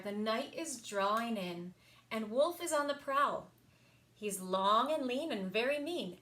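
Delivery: usual speaking voice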